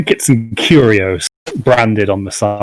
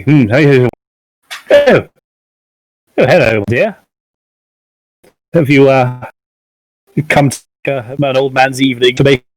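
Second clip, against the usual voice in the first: lightly voiced old trader roused from a nap; elderly merchant, startled awake; 5 semitones higher than usual. Old merchant waking as a customer coughs, lightly startled, welcoming. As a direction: come out of a doze into a kindly welcome.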